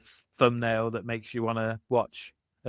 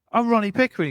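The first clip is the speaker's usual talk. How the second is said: higher, louder mock yell of the name; Ronnie Pickering shout; 11 semitones higher, 6 dB louder, faster than usual. Road-rager announcing himself to the other driver, shouting high. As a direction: bark his own name like it should mean something. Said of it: "He's like".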